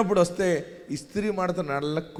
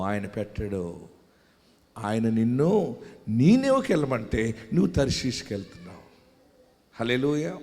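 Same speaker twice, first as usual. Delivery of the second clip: ordinary talk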